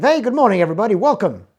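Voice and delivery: loud, high, upbeat meeting opener; rally the room for a brainstorm; fun, motivating greeting. Shown as a demonstration. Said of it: "tone of voice that reflects the objective".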